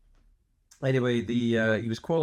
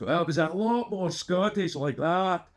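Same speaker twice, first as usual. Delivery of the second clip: slow, high-pitched, thick Scottish accent; Gobber, more Scottish; 8 semitones higher, slower than usual. Shown as a demonstration. Showing his dragon cartoon role, much broader Scottish. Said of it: "Gobber voice, I would talk a lot more".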